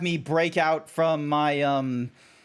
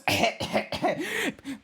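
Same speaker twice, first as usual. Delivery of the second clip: high, sputtering cough; brief high-pitched coughing fit; 10 semitones higher than usual. Acted. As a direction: cough in the middle of the act. Coughs inside the furry act.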